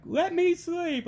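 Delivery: put-on high, slow whine of a sleepy kid; sleepy daughter. Daughter to dad, whining to be left asleep. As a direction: groan it like a child who won't get up. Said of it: "she'd be like".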